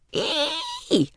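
high, small delighted squeal; thin high-pitched "ee"; baby's squeal. A narrator's reading. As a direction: squeal like a pleased baby. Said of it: "squealed Roberta".

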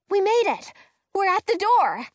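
high, loud triumphant cheer; excited. A narrator's reading. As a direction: celebrate reaching the door. Reaching the castle door.

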